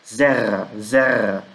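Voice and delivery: "zerra" as ZÉ-ra, falling; stress on first syllable. Shown as a demonstration. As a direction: hit the open "ze" of "zerra", let "ra" drop. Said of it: "Lembrando a sílaba tônica aqui".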